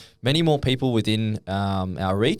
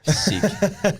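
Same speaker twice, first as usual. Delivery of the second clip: excited; 11 semitones higher than usual. Real, not acted. High drawn-out reaction.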